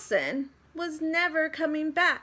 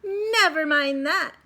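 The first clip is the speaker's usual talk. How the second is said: sharp, louder snap; snapped; 5 semitones higher, 6 dB louder than usual. A narrator's reading. Miss Swamp snapping at the kids, sharp and loud. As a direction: bite the words off. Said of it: "snapped Miss Swamp".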